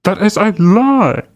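put-on high, drawn-out Kermit Cajun accent; Rodney Howard-Browne. Host parroting the preacher's clip, mocking Kermit Cajun accent. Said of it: "Kermit Cajun. That's that accent".

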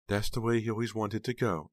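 high, plaintive mourning voice; grieving aunt. A narrator's reading. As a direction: lift the voice into tender grief. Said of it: "She broke down into loud sobs".

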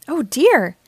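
mildly alarmed exclamation; startled gasp. A narrator's reading. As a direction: react to a sudden bang. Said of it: "gasped Grandpa Pig".

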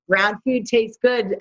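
ordinary talk, nothing marked

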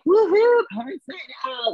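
high, loud whoop falling away; mock party cheer. Couple off to New Year's Eve party, whooping high.

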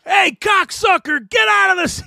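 angry rock star shout. Retold or imitated. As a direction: bark it from the stage. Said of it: "and he'd be like".

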